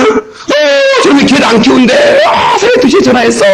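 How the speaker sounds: high, hammed-up furious wail; comic shouted exasperation, pitched high; enraged reaction. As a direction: mimic someone losing it on the phone. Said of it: "화나고 막 개 키우고 막 뛰는 거야".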